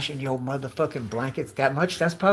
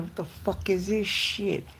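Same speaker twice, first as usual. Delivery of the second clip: higher, incredulous complaint; appalled disbelief; 6 semitones higher, 4 dB quieter than usual. Acted. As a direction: act disgusted at what was handed over. Imagined reaction to wet blanket.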